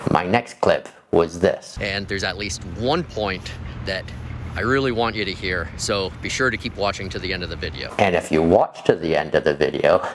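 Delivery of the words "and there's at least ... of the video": soft, hushed aside to camera; quiet aside. Acted. Quiet aside in the old clip.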